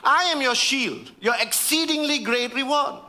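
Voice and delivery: high, insistent divine reply; voice of God. Acted. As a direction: make God's answer ring out. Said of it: "He said".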